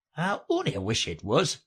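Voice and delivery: ordinary delivery, nothing marked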